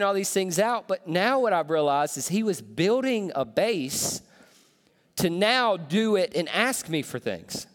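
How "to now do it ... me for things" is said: ordinary talk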